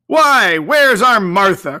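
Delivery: put-on a father bursting in looking for his girl; Bob crying out. A narrator's reading. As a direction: Bob calling out for Martha. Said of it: "cried Bob Cratchit".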